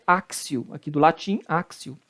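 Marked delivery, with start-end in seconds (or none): 0.1-0.7 axio with an S sound, "áksio"; correct Latin pronunciation. Shown as a demonstration. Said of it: "a pronúncia correta é". 1.5-2.0 axio with an S sound, "áksio"; correct Latin pronunciation. Shown as a demonstration. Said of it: "a pronúncia correta é".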